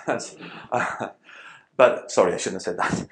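breathy laugh through the words; laughing it off, sorry through the giggles; laughing apology. Real, not acted. Laughs at own joke.

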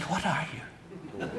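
very soft, slow, low mock-secret question; hushed reply. Israeli woman asks back in the shared secret, hushed. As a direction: ask it under the breath, slowly, retelling her reply. Woman answers his conspiratorial whisper.

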